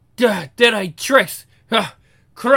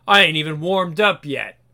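ordinary talk, nothing marked

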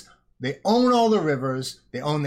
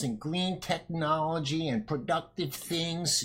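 put-on old woman voice; 5 dB quieter than usual. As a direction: croak it like a frail old lady. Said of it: "she says in her old ... voice".

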